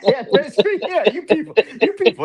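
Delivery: cracking up as he says it; giggling high through you people; laughing. Real, not acted. Laughing while mocking phrase.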